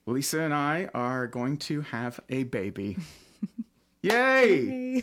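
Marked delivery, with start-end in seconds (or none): 4.0-5.0 high, loud, drawn-out cheer; celebratory cheer. Real, not acted. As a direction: cheer the big news. Celebrating the baby announcement.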